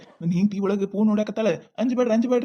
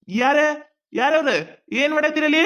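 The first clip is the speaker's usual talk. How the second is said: loud, higher call; 6 semitones higher, 4 dB louder than usual. Acted. Man catching the women at his gate, loud higher call. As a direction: call out who's there.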